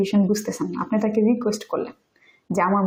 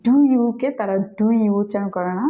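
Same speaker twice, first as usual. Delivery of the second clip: ordinary talk, nothing marked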